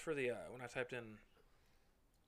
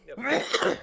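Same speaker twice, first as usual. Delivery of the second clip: loud squeaky yep; 11 semitones higher, 14 dB louder than usual. Acted. Playful answer about the Pope.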